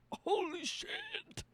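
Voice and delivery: slow high holy shit; shocked kid. Retold or imitated. Kid stunned by the ending, slow and high. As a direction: stretch it out in disbelief.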